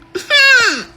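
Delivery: high bursting laugh; real laugh. Real, not acted. Storyteller cracking up at her story, high laugh.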